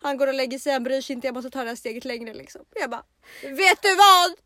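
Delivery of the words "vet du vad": loud, high, defiant announcement; acted angry threat. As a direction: storm out theatrically, voice raised. (högljutt, dramatiskt hot att gå)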